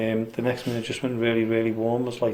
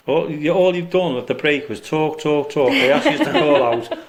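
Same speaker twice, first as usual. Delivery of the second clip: laughing; 4 semitones higher, 5 dB louder than usual. Real, not acted. Teasing friends, laughing.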